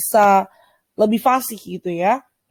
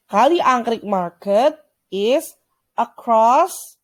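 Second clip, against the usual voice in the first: slow model reading; much slower than usual. Shown as a demonstration. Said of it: "teacher models English phrase".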